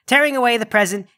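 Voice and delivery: ordinary talk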